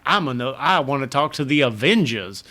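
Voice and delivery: exaggerated Southern-accented speech; thick Southern accent. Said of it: "super thick accented, uh, Southern accent".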